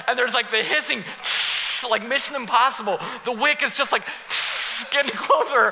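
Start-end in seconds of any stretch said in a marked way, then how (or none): 1.2-1.8 fuse hiss. Retold or imitated. Telling the church about the bottle rocket, a fuse hiss. 3.9-4.9 fuse hiss. Retold or imitated. Imitating a burning fuse.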